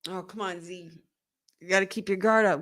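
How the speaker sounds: ordinary talk, nothing marked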